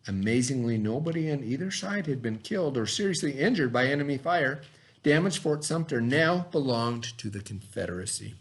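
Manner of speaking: measured read-aloud to a class. Acted. Teacher reading the Fort Sumter story to the class, read-aloud. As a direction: read the text clearly to young students. Reads history text to class.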